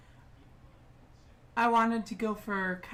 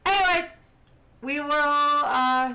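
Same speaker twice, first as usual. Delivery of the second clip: big sign-off voice; 5 semitones higher, 8 dB louder than usual. Real, not acted. Sign-off announcement.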